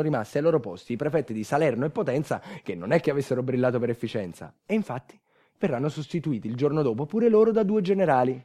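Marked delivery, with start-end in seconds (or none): none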